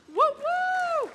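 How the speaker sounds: high excited woo woo; loud celebratory whoops; cheering. Real, not acted. Cheering alumni standing.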